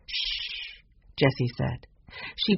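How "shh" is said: soft, hissed shush. Acted. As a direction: hush someone very quietly. Jessie hushes Benny in hall.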